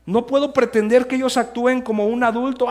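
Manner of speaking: high, loud, pleading sermon voice; fervent preaching. Acted. Pastor urging parents not to stress their children, preaching loud and high. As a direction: preach it hard to the congregation.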